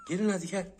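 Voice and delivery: high, loud sing-song call to the kittens; high coaxing call. Acted. Owner coaxing the kittens out of the box, in a high, loud sing-song call. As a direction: coax them out like calling a pet.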